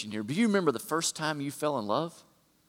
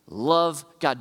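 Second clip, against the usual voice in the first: normal talking voice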